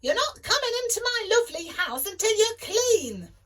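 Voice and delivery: cross mum. A narrator's reading. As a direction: scold him like an angry mother. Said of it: "said Jack's mum".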